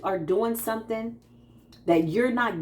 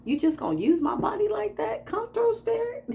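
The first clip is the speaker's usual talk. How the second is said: higher, playful disbelief at Spirit; teasing Spirit, amazed and higher; mock amazement; 11 semitones higher than usual. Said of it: "I'm like".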